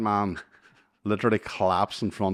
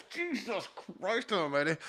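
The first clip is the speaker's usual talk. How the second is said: exasperated; 11 semitones higher, 7 dB quieter than usual. Retold or imitated. Exasperated reaction.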